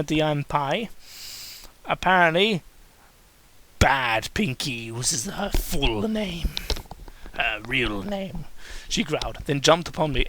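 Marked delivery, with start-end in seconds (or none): 3.8-8.4 slow ominous. Acted.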